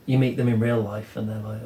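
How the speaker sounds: ordinary talk, nothing marked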